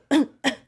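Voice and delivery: hacking cough. Real, not acted. Coughing up popcorn.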